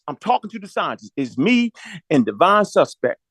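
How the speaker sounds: ordinary delivery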